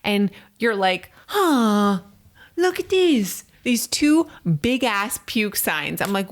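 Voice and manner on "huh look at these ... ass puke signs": high, slow, dopey imitation of the husband; mocking husband. Wife imitating her husband finding the puke stains, high and slow. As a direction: play him as clueless and surprised.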